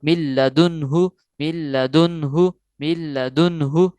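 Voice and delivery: من لدنہ very slow, نون dropped into the doubled لام; no ghunna. Shown as a demonstration. Teacher demonstrating to students: من لدنہ slow with no ghunna. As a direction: recite من لدنہ slowly without nasal hum. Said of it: "ادھر بھی غنہ نہیں کریں گے".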